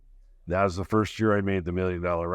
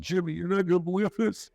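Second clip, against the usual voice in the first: high, tearful voice, much higher pitched; retold crying call; 10 semitones higher than usual. Calling his old trainer Jimmy after being fired, tearful and high. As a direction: choke it out like the tears are still coming. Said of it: "I'm crying my eyes out".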